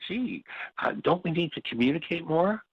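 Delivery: mocking the partner who pleads for communication; mock innocent plea. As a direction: parody a wide-eyed, faux-innocent question.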